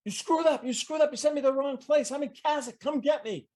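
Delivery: high, whiny panicked gamer; panicked player. Retold or imitated. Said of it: "he says".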